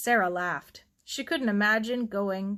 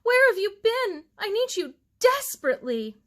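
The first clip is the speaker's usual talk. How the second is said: high, louder desperate plea; moaning plea; 12 semitones higher, 5 dB louder than usual. A narrator's reading. A frantic bride begging Sarah for help, high and loud. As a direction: cry out for help, pleading hard. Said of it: "she moaned".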